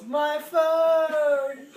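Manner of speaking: high, loud drawn-out yell; mock yell. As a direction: imitate a wailing yell, stretching out the last word. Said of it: "As Devin yells".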